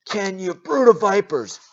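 preacher urging viewers at home, yell. Shown as a demonstration. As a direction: yell it at the screen. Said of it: "you can yell it at me".

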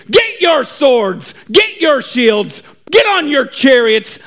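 rally the troops to arms; rallying cry. Acted. Preacher rounds up our army, rallying cry.

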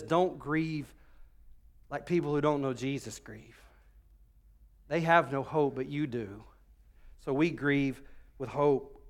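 soft, low reassurance; gentle comfort. Retold or imitated. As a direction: quietly console the bereaved church. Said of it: "He just simply says".

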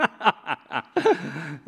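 short laugh at the price tag; disbelieving laugh. Retold or imitated. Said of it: "And I went".

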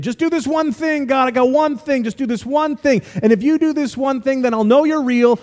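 high-pitched, insistent bargaining with God; pleading kid's prayer. Retold or imitated. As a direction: imitate a kid begging in prayer. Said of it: "when I was a kid".

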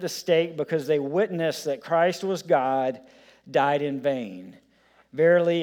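put-on impassioned reading of an old sermon, phrase by phrase; declaimed quote, each period falling to a weighty close; fervent sermon reading. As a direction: read it out as preaching, with swelling emphasis. Reads Spurgeon's quote to congregation.

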